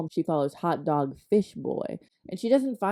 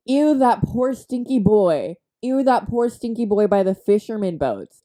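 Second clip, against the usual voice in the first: exaggerated mean-girl disdain; mock disgust; 3 semitones higher, 6 dB louder than usual. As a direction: play a snooty bully, loudly disgusted. Acts out bully sneering at boy.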